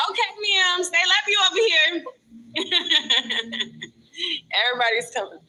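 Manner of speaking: ordinary delivery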